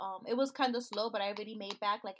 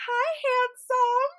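slow, much higher and louder flirty greeting; drawn-out come-on from a flirt; flirty marketing lady; 14 semitones higher, 11 dB louder, slower than usual. Retold or imitated. Marketing lady fawning over her brother, a slow high flirty greeting. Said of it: "she's like all over my brother".

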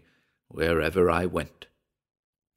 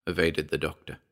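plain reading voice

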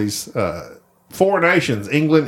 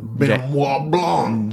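very slow Georgian drawl; drawn-out, hammed-up Southern Georgian voice; Georgian accent; much slower than usual. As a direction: stretch the name in a mock Georgian accent. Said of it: "that weird Georgian accent off that Glass Onion".

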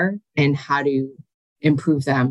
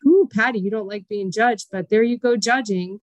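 higher, teasing self-talk; self-scolding inner voice; 6 semitones higher than usual. Retold or imitated. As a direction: scold yourself playfully. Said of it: "I'm like".

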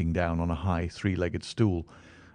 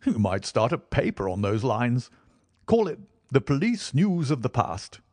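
Stamford voice; 6 semitones higher, 4 dB louder than usual. Acted.

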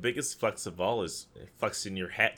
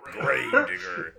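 monster truck announcer; 4 semitones higher, 4 dB louder, slower than usual. Pretend. Echoing the monster truck joke.

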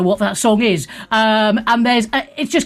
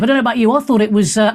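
ordinary talk, nothing marked

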